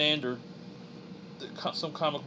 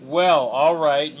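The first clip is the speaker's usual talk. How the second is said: drawn out; 9 dB louder, much slower than usual. Real, not acted. Friend just left to pee.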